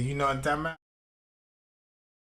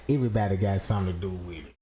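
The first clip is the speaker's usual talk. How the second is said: lightly voiced deep mutter; 13 semitones lower, much faster than usual. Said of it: "I'm like".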